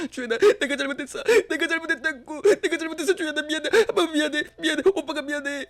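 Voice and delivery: high, weepy begging; tearful pleading apology. Acted. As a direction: plead through tears, voice cracking. Brother begging forgiveness tearfully.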